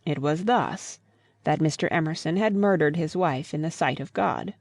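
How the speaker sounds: plain reading voice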